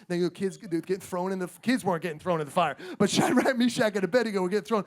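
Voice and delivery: ordinary talk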